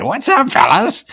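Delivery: high, slow laughing greeting; Wenceslas voice. Pretend. Host greets family as Wenceslas, high and slow. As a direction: greet them in the silly king voice. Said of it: "call your family on Christmas and say".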